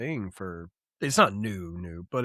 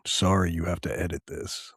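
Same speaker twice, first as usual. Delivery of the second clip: lower voice; 6 semitones lower than usual. Pretend. Addresses the editor in a lower voice.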